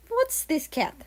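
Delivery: put-on a gruff Russian accent, lightly done; heavy Russian accent. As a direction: ask it as a curious Russian. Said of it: "spoke with a heavy Russian accent".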